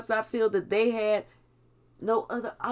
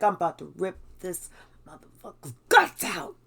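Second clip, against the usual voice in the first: lower, menacing true self; sinister inner voice; 4 semitones lower than usual. Retold or imitated. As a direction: drop the sweetness and let the menace out. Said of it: "she's like".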